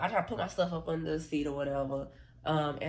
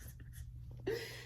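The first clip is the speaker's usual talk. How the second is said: breathless laugh; 12 dB quieter than usual. Real, not acted. Laughing at her own story.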